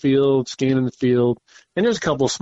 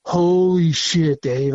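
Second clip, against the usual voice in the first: excited exclamation; 5 semitones higher, much slower than usual. Retold or imitated. Spotting a monster buck.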